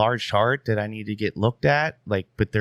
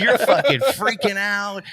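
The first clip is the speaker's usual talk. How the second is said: laughing mock dad; 8 semitones higher, 4 dB louder than usual. Dad scolding, retold laughing.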